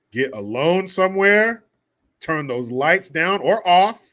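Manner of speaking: slow urging. Acted.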